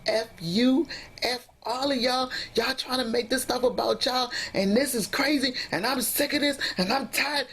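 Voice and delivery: fed-up outburst, reenacted; heated rant. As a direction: rant in rising exasperation, no real shouting. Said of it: "now I'm screaming and crying".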